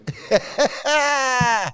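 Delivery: short, high giggle; embarrassed laugh. Real, not acted. Admitting he's being rude, a high laugh. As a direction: laugh it off, a bit sheepish.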